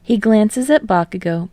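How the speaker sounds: plain reading voice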